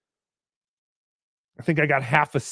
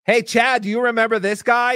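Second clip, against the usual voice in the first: mock show host; 8 semitones higher, 4 dB louder than usual. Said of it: "And be like".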